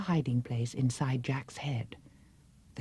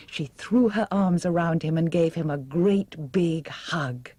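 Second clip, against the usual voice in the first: ordinary delivery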